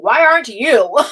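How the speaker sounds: laughing comeback to her son; laughing retort. Real, not acted. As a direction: toss the question back with a laugh.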